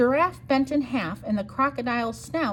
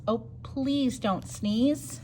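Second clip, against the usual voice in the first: pleading plea; 2 semitones higher, much slower than usual. Acted. Fish pleading refrain.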